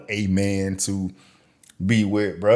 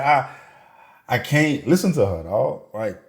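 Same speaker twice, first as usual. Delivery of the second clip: ordinary talk, nothing marked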